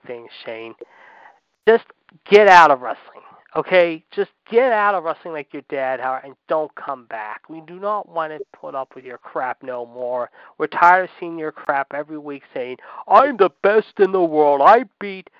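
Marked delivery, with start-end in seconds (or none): 1.7-6.0 loud, forceful order to leave; exasperated demand. Real, not acted. Fed-up demand to leave wrestling. 13.1-15.3 loud, slow imitation of a braggart; mocking boastful wrestler.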